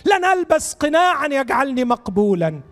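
real heartfelt congregational prayer; fervent prayer. Real, not acted. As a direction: plead to God, phrases rising high then falling away. Leads a fervent prayer aloud.

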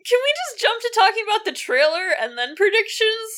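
squeaky voice after crying. Real, not acted. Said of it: "I'm sorry my voice is so squeaky".

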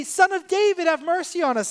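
high, quick cry for mercy; acted plea. Blind men begging Jesus for mercy, high acted plea. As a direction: call out as a retold beggar, pitched well up. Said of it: "So they say".

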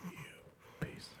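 very slow, hushed whisper; mock whisper. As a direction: whisper it slowly right into the mic. Mock ASMR whispering into microphone.

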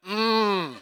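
usual speaking voice

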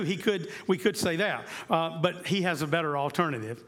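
usual speaking voice

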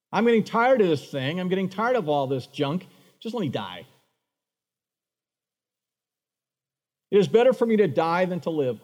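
hammed-up sulking prophet begging to die; Jonah as a drama queen. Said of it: "Drama queen once again".

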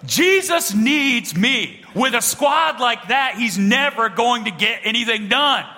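high, drawn-out mock proclamation; grandiose child. Ten-year-old telling dad Jesus needs him, mock grandiose. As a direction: declare it with comic self-importance.

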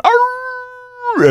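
very high imitated puppy howl; mock puppy howl at an elk bugle; dog howl. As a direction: howl like an excited Labrador. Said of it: "he's sitting there going".

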